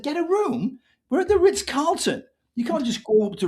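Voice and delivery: very high-pitched, retold disbelief on "Get a room"; incredulous echo. Man balking at booking a room at the Ritz-Carlton, incredulous. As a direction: throw the demand back as an outraged question.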